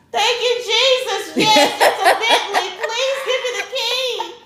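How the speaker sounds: high, loud, slow hallelujah wail; jubilant relief. Retold or imitated. Shopper to the clerk who has the lost key, jubilant wail. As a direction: belt out overjoyed thanks, half laughing.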